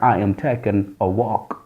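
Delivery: put-on a low, dismissive mutter to the girl; flat brush-off.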